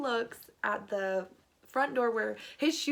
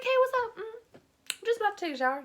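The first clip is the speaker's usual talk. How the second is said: high, chirpy fake-casual voice; fake innocent; 11 semitones higher, 4 dB louder, much faster than usual. Teen covering for a hidden boy, high and fake-casual. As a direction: play it breezy and innocent.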